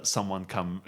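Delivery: ordinary delivery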